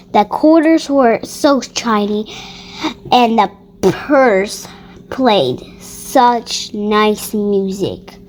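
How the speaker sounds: ordinary delivery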